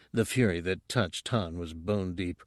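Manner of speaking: plain reading voice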